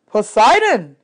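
loud, solemn naming of a god; proclaiming Chiron. A narrator's reading. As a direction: declare the name like a verdict. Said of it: "said Chiron".

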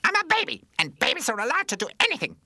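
put-on very high, loud taunting baby; bratty baby voice. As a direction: brag like a spoiled baby. Pamper taunts back.

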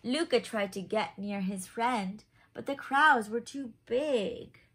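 lively picture-book narration; reading to children. A narrator's reading. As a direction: read it like a bedtime story for little ones. Reads picture book aloud.

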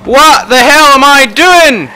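high, loud, disbelieving shout at himself; exasperated yell. Real, not acted. Gamer raging at his own bad play, exasperated yell. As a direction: blow up at your own mistake.

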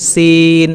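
সিন held long on the ee; drawn-out letter name. Shown as a demonstration. Teacher repeating after saying stretch it, long সিন. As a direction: dental s, then stretch the ee in সিন. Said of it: "একটু টেনে".

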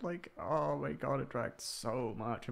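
slow, high whine about the work drag; whiny complaint. Real, not acted. Vlogger whining to viewers about work, drawn out and high. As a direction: moan it out, dragging every word.

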